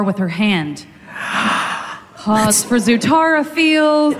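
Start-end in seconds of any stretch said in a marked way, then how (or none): 1.1-2.0 sharp voiceless intake of breath; gasp. Acted. Katara touches Zuko's scar, audible gasp. 2.2-4.2 slow, stretched, higher-pitched aside; milk the romantic pause for the crowd; drawn-out mock swooning. Reader pauses for the shippers, drawn-out comic swoon.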